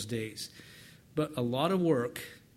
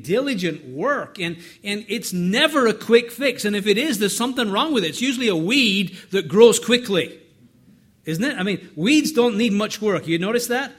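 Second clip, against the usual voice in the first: louder and higher, pressing each point home; preaching at full force; 6 semitones higher, 10 dB louder than usual. Acted. Preacher rousing the congregation about quick fixes, loud and forceful.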